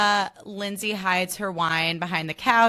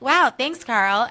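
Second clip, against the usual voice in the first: high, loud fake-bright thanks; mock Lindsay; 5 semitones higher, 7 dB louder, much slower than usual. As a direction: brush him off brightly. Imitating Lindsay's brush-off.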